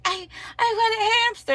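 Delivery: put-on high, fast teary little-girl voice; crying child voice. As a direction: whimper like a kid in tears. Crying daughter voiced by mom.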